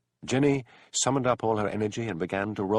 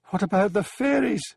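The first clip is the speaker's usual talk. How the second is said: high, loud panicked screech; frightened screech; 13 semitones higher, 6 dB louder than usual. A narrator's reading. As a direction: shriek it in terror of the fairies. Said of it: "screeched Mary".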